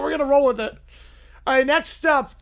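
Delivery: normal talking voice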